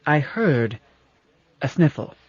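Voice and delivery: ordinary delivery, nothing marked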